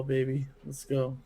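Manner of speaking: ordinary talk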